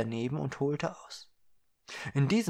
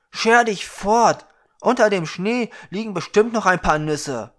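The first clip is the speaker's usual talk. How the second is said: angry dismissal; 7 semitones higher, 10 dB louder than usual. Acted. Young man shooing the beggar girl away, loud and angry. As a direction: chase her off with contempt.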